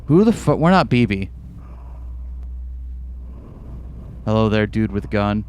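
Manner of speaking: low, ominous character voice; deep storyteller voice. Acted. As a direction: tell it low and eerie. Deep character voice telling lore.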